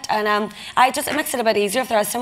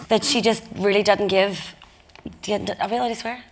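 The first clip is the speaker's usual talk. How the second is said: ordinary talk, nothing marked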